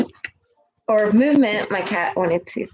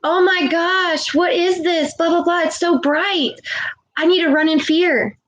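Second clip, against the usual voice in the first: high, mock-panicked voice; hammed-up mock fear at the bright truth; mocking frightened people; 7 semitones higher than usual. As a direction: parody a scared person, higher. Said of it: "And you're like".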